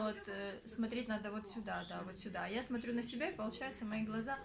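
ordinary talk

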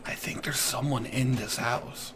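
soft unvoiced stealth whisper; tense hushed whisper. Real, not acted. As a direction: hush the squad, barely breathing. Suspects enemy in house.

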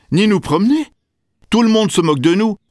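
high, quickening mama hippo voice; complaining mother hippo. A narrator's reading. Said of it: "ajouta Maman Hippo". (voix aiguë de maman hippopotame qui se plaint)

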